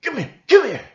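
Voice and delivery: loud, high mock mobster threat; hammed-up mafia goon calling someone over; mafia heavy Luigi. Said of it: "do a Luigi from the mafia".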